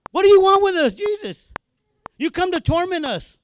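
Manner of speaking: high, protesting demon quote; a hint of panicked demons; lightly voiced demons. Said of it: "the demons manifested and says".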